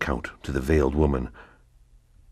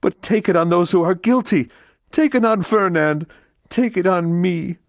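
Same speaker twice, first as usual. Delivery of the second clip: much higher, louder desperate mother; Mercedes pleading; 6 dB louder than usual. Acted. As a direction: beg him to spare her son. Mother begs for her son.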